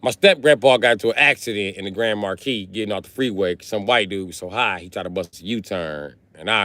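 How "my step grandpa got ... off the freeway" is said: normal talking voice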